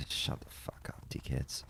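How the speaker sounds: very soft, whispered swearing; muttered dismissal. Real, not acted. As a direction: curse them under your breath. Muttered dismissal of those players.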